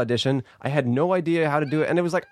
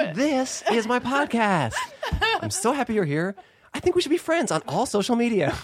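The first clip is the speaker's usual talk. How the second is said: high, gushing host intro; Alexi Wasser impression; 8 semitones higher than usual. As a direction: mimic a podcast host making eager friends with listeners. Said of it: "Do an impression of me".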